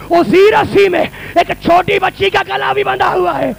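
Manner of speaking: put-on a courtier pleading for the child, wailing; wailing lament. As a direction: cry it out in grief. Climax of court scene.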